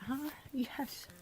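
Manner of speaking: usual speaking voice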